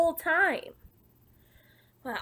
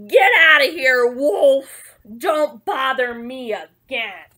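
loud, angry storybook shout; big yell, slowing on the last words; rude retort; 10 dB louder, slower than usual. Acted. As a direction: bellow the wolf off the doorstep. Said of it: "that rude little porker answered".

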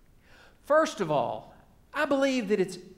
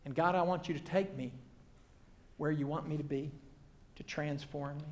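low, much softer, humble plea; hushed prayer; 6 semitones lower, 11 dB quieter, faster than usual. Acted. Preacher praying to God to transform him, hushed and low. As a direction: let it sink softly toward near silence.

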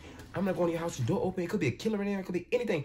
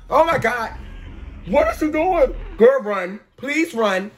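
loud, high and scared, begging; panicked pleas at the screen; frightened yelling; 7 semitones higher, 6 dB louder, slower than usual. Real, not acted. Pleading with the girl on screen, scared and loud. Urging character to flee.

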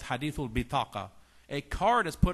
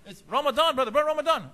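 high, loud, frantic objection; mock alarmed protest; 12 semitones higher, 11 dB louder than usual. Brother scolding a man eating in Ramadan, loud alarm. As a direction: cry out in comic shock at a rule-breaker.

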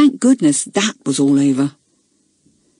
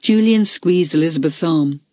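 ordinary delivery

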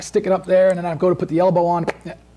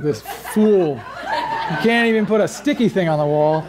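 laughing mock taunt. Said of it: "you're gonna be like".

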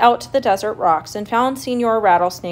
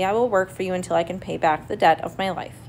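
ordinary delivery, nothing marked

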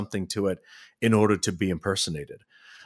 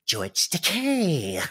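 Mister Burns impression; 4 semitones higher, slower than usual. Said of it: "Mister Burns right there".

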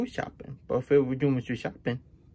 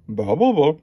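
mocking blah; 6 dB louder than usual.

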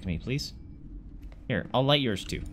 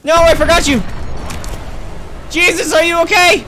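much higher, much louder frantic yelling; panicked screaming; 13 semitones higher, 11 dB louder than usual. Real, not acted. Gamer screaming at Jesus during the attack, frantic. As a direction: scream through the chaos.

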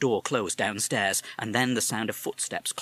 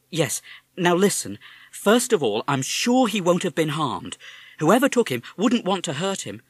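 mother; 8 semitones higher, 7 dB louder than usual. Acted. Mother reassures son.

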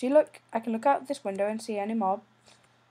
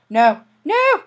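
rising panicked cry of no; yelling no as darkness falls; 5 semitones higher, 13 dB louder than usual. Real, not acted. Alone in Minecraft at nightfall, panicked cry.

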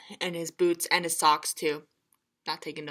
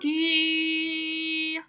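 higher, drawn-out call; sing-song call from afar; long calling mother; 7 semitones higher than usual. Acted. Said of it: "called his mother".

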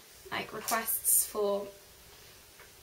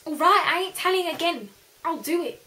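put-on Welsh accent; 6 semitones higher, 8 dB louder than usual. Continuing the Welsh accent bit.

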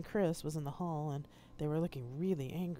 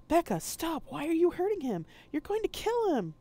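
yelled much higher and louder; desperate yell; 13 semitones higher, 8 dB louder than usual. Acted. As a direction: scream at Becca to stop beating him. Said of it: "I yelled".

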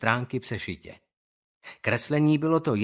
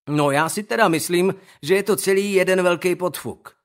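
drawled; 5 semitones higher, 6 dB louder than usual. Acted. Owen drawls from the back row, sneering. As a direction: stretch the words out, bored and cocky. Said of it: "protáhl ze zadní lavice Owen".

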